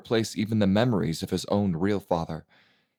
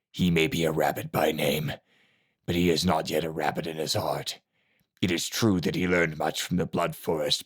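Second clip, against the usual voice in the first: gravelly master voice; 4 semitones lower than usual. A narrator's reading. Silent Master voice, slow.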